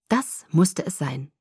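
ordinary delivery

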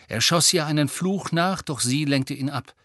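plain reading voice

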